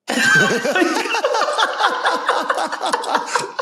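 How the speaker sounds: a long high cackle breaking out, real; can't hold the laugh in; real burst of laughter. Real, not acted. Cracking up at the nurse's question, a real high laugh.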